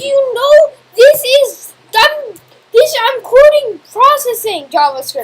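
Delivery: high, loud, drawn-out complaint; loud protest. Real, not acted. Student protesting to tutor about code, high loud complaint. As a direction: wail your objection at full volume.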